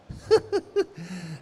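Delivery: loud, high, giddy laugh of disbelief; delighted laugh. Real, not acted. Joyful disbelief.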